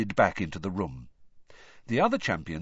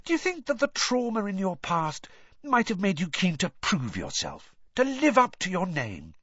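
Rita Skeeter; 10 semitones higher than usual. A narrator's reading. Rita probes Harry's past.

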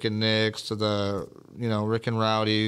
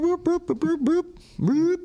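high, sung boops like a button; imitating a beeping sound. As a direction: chirp each boop like a sound effect. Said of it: "My other one goes boop".

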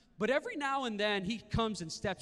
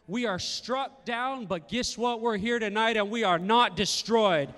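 raised pitch, building to loud triumph; building declaration; 5 semitones higher, 7 dB louder than usual. Real, not acted. Preacher finishing the verse for the church, building loud. Scripture reading building to a climax.

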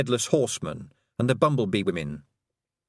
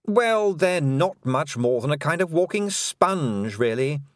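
higher, silky voice of a mocking fairy queen; lightly higher, condescending queen; 6 semitones higher than usual. A narrator's reading. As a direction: purr it out as the cruel Queen, sweetly taunting.

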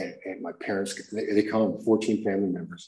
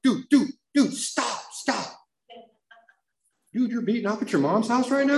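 much higher, urgent interruption; alarmed sponsor; 13 semitones higher than usual. Retold or imitated. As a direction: cut him off in alarm. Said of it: "And he's like".

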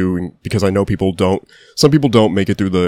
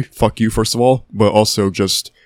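normal talking voice

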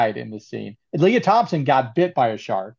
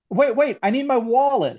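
higher-pitched mock urgency; imitated movie guy; 9 semitones higher than usual. Retelling a guy wanting back into the tunnel, mock urgent plea. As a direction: plead as a panicky bit-part character, lightly. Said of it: "Some guy goes".

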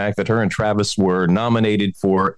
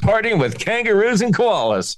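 excited; 10 semitones higher than usual. Real, not acted. Celebrating Australia listeners.

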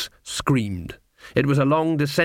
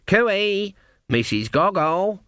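high, sing-out hail; high "cooey" call, then a drawn-out name; 7 semitones higher, slower than usual. Acted. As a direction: call to someone across a hall. Calling across a crowded hall.

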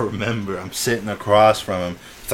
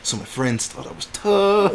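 higher, drawn-out whiny excuse; mock pleading; 7 semitones higher, slower than usual. Cowed kid explains his act to Caleb, mock pleading.

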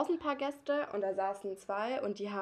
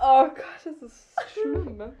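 louder and slow, laughing through it; 5 semitones higher, 11 dB louder, slower than usual. Real, not acted. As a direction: still laughing. Lacht noch. (lachend, langsam)

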